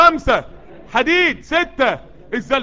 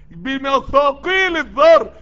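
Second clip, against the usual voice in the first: بمثاقيل الذر drawn out slowly, louder and higher; slow emphatic الذر; stress on الذر; 4 semitones higher, 6 dB louder, much slower than usual. Acted. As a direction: linger on الذر for awe. Stressing the tiny weight.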